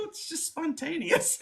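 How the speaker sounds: high, slow, airy reply; breezy high quote. Retold or imitated. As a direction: toss it off lightly. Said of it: "And I go".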